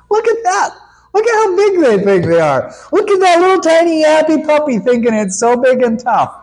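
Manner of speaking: high, fast, teasing voice; God amused by boastful little rebels; amused mockery. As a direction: talk down at a yapping puppy. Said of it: "He's going".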